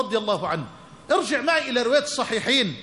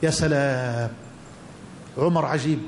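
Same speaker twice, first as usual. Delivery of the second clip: awed; 10 semitones lower than usual. Real, not acted. Preacher marvels at Umar.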